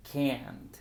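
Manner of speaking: "can't" with an open "e" vowel and a clear t; careful can't; full can't. Shown as a demonstration. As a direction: say "can't" slowly, fully voiced. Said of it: "va a sonar un poco como can't".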